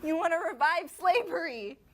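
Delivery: loud, indignant outburst; outraged. Acted. Host confronting a guest over slavery, outraged.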